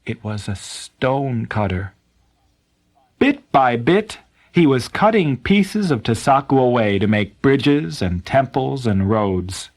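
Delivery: usual speaking voice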